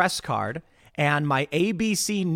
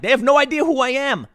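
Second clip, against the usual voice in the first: retold exclamation; 9 semitones higher, 5 dB louder than usual. Retelling the crowd's confused reaction.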